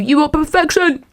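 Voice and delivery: high, put-on adoring voice; mock gushing flattery. Boss flattering an offended staff member, in mock gushing tones. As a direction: gush it in a high imitation.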